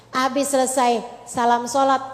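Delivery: ordinary delivery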